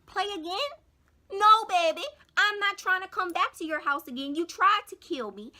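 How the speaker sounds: high, loud, outraged retort; indignant. Retold or imitated. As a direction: shut her down, sharp and loud. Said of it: "And I'm like".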